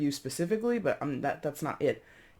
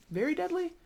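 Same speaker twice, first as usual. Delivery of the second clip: "very deadly?" asked far higher, questioning the word; doubtful question. Real, not acted. Reader doubting his own "very deadly" with a high question.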